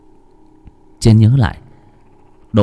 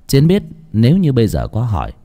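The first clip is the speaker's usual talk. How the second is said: plain reading voice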